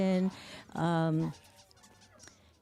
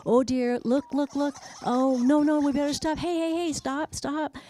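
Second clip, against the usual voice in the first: higher, louder urgent warning to the others; alarmed cartoon bird; 7 semitones higher, 4 dB louder than usual. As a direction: voice a worried bird calling the gang off. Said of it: "He's saying to the other ones".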